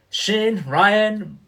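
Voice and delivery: ordinary delivery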